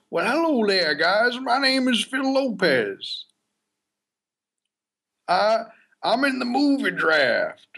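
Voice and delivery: exaggerated friend voice, high and slow; Phil Lopez impression. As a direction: pitch it way up and drag it out like a goofy buddy. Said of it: "working on my Phil Lopez accent".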